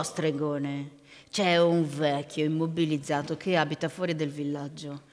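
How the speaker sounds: low, dark ogre confiding in a sorcerer; low-pitched storybook ogre; deep ogre voice. A narrator's reading. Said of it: "la mia voce profonda".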